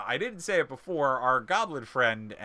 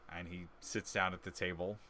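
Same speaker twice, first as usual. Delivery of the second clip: usual speaking voice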